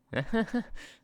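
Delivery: sudden high-pitched disbelieving laugh; a startled, high laugh; high incredulous laugh. Real, not acted. Reaction to referee decision.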